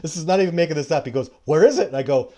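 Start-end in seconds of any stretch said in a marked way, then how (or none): 1.4-1.9 high, loud retold question; eager demand. Survivor Man asks where the scat is, retold with loud eagerness. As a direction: act out an urgent, eager demand.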